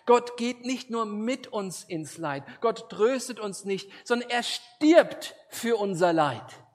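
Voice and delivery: loud, high, driving pulpit voice; forceful preaching. Acted. Preacher pressing the cross on the congregation, loud and driven.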